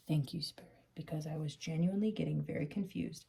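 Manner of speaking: calm the viewer into forgiving; soothing. Real, not acted.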